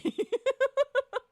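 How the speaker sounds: high squealing laugh. Real, not acted. Streamer cracking up at the sauce list, high laugh. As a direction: burst into a high giggle.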